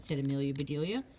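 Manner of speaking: ordinary delivery, nothing marked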